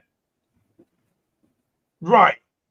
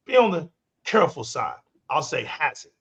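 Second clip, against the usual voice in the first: usual speaking voice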